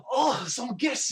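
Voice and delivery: high, loud, drawn-out excitement; delighted greeting. Acted. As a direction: act thrilled at surprise visitors. Dwarf overjoyed to see guests.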